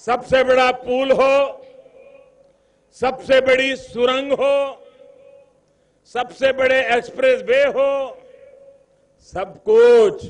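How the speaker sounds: loud, high triumphant listing; shouting rally speech. Real, not acted. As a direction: build the list to a roar. Rally crowd, listing big achievements.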